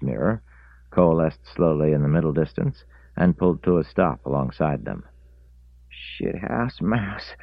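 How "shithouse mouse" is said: slow, high mock cry; drawn-out old man yelp; old man exclaiming. A narrator's reading. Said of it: "cried Reese".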